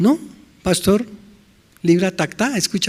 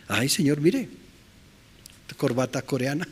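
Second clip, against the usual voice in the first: meek pleading; 8 dB quieter than usual. Acted. Sinner pleading with the Lord.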